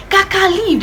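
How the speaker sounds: brother's last warning, imitated; exasperated order. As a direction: snap it like you're done with her. Brother ordering her away.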